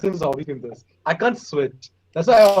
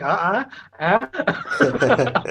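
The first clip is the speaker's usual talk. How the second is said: stumbling stammer; 6 semitones higher, much slower than usual. Retold or imitated. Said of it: "I'm like".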